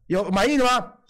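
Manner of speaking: usual speaking voice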